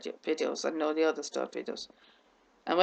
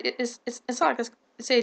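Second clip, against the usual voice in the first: stuck and repeating the first word; real stammer, restarting on "it's"; speech slipping; 5 semitones higher than usual. Real, not acted. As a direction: trip over "it's" and break off unfinished. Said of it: "I'm going off again".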